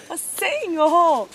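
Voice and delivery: loud, drawn-out cry to the Lord; long, high exasperated appeal; exasperated plea. Retold or imitated. Retold dismay at broken nose.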